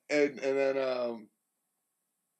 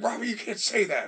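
scolding mom; 10 semitones higher, 6 dB louder than usual. Retold or imitated. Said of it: "She's like".

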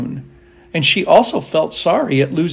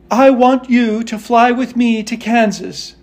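lightly voiced, higher-pitched girl; pleading Dorothy; 7 semitones higher than usual. A narrator's reading. Dorothy asking the Monkey King, high and earnest. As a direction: ask earnestly in a raised voice. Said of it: "said Dorothy".

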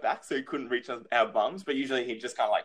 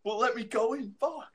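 put-on high-pitched kid's whine of protest; mock panicked protest; 12 semitones higher than usual. Kid at the door facing a smack, mock panic. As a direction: imitate a kid desperate to get past.

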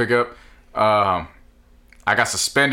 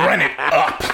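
laughing; 3 semitones higher, slower than usual. Real, not acted. Laughing slow chant.

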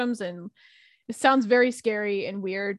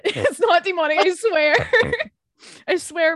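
laughing; 7 semitones higher, 6 dB louder than usual. Real, not acted. Laughing while denying it's demonic.

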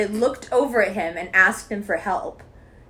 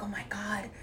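soft, half-whispered mock awe; hushed admiration; 10 dB quieter than usual. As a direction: imitate a smitten guy, very softly. Retells bartender's awed compliment.

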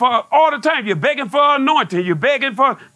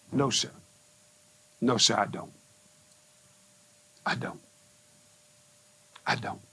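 much softer, lower humble "no, sir"; meek submission; 12 semitones lower, 12 dB quieter than usual. Retold or imitated. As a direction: answer God small and meek. Meek answer to God.

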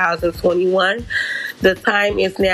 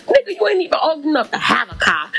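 sassy taunt; 7 semitones higher, faster than usual. Retold or imitated. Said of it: "So now I'm talking shit".

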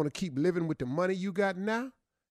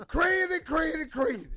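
crazy man voice; 9 semitones higher than usual. Pretend. New crazy man character.

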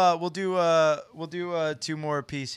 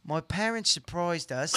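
put-on slow, careful British accent; put-on British, word by word; 4 semitones lower, 7 dB quieter, slower than usual. Player reading on request, in a British accent. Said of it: "with a British accent".

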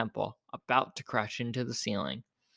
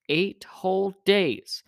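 slow, high, weighty delivery; drawn-out, higher-pitched emphasis; emphatic answer; 6 semitones higher, 3 dB louder, slower than usual. A narrator's reading. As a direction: spell out the bad news slowly. Man answers how long it takes.

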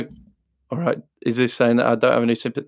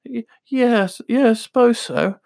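put-on hesitant boy; 10 semitones higher than usual. Son's hesitant reply.

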